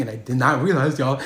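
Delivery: ordinary talk, nothing marked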